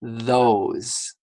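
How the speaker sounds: "Those" with the tongue out, a clear th; exaggerated th. Shown as a demonstration. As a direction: stretch the th of "Those". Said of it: "You need to make sure your tongue comes out".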